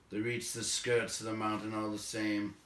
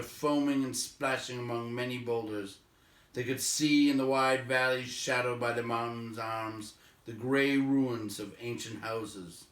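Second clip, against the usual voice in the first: usual speaking voice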